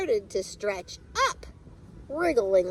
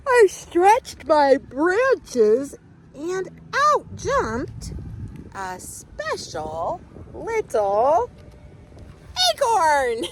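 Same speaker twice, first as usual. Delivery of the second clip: 1 slow stretching yawn; 7 semitones higher, 5 dB louder, much slower than usual. Acted. Tree yawning, stretching.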